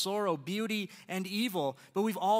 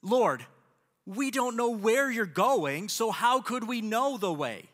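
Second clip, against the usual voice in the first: frustrated plea, slow and louder on where; pleading, fed-up disciple; exasperated; 3 semitones higher, 5 dB louder, slower than usual. Retold or imitated. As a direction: Thomas throwing up his hands at Jesus. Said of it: "you gotta imagine he's a bit exasperated here".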